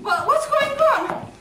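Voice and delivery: loud, high, drawn-out question; alarmed demand. Acted. Demands to know what is happening.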